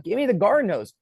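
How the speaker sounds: high, loud yelled demand; insistent shout. Retold or imitated. Man arguing from the roof for the hose, insistent shout. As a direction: shout it back, refusing to budge.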